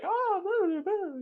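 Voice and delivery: very high, silly falsetto woman voice; womanly voice. Shown as a demonstration. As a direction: squeak it out like a cartoon lady. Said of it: "my womanly voice to come out like".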